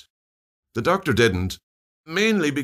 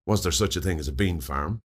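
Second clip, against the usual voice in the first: ordinary delivery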